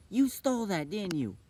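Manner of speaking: high, loud accusation; mock angry mom. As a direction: play a mom catching the kid out, loudly. Retold angry mom accusing her child.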